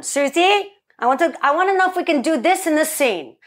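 high-pitched imitation of a fanatic producer; Avi Arad. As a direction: call out eagerly, hammed-up long-distance caller. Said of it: "he'd be calling me from Japan".

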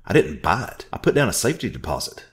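shouting. Acted. Narrator shouting at Orville, loud. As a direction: shout her defense. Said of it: "I shouted".

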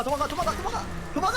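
panicked rising yells. Real, not acted. Keeps yelling, higher. (더 다급하게 높이 외치며)

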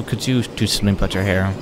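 normal talking voice

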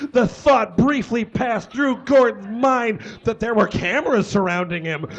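normal talking voice

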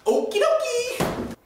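very high, slow and cheery; high chirpy voice. Acted. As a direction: sing it out like a cartoon. Cheery sales sign-off.

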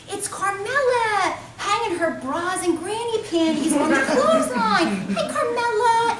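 high, slow, dainty mockery; squeaky prissy homeowner; high-pitched voice. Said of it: "in a high-pitched voice".

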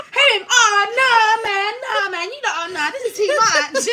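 high, laughing cry of oh no; half-laughing alarm at the chaos; mock panic. Voicing panic during the fight.

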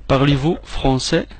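ordinary talk, nothing marked

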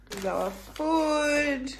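ordinary delivery

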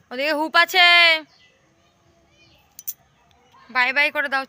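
loud call across the roof; calling out loudly. Real, not acted. (জোরে ডাকা)

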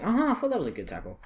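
normal talking voice

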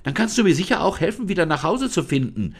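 put-on high, fast, eager girl's voice; excited. Lisa begs the spirit to guide her home, excited. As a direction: rush the request, high and eager. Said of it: "rief Lisa aufgeregt".

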